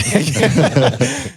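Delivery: breaks into a high laugh. Real, not acted. Laughing while telling the guest about Sarah. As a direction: laugh at the memory of it.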